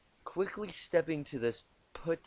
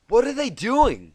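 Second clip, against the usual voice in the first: high, loud, slowed panic; frantic; 11 semitones higher, 13 dB louder than usual. Acted. Twilight asks frantically under the snowball fire. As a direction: ask in a frantic rush. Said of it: "she asked frantically".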